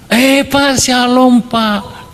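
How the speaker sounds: very high, hearty put-on greeting; imitated eager congregant. Churchgoer greets the preacher at the door, high effusive imitation. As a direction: hail the pastor with gusto.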